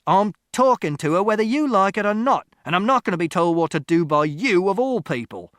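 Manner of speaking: loud, high-pitched angry outburst; angry shout. A narrator's reading. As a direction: shout down someone blocking you. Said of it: "Father shouted".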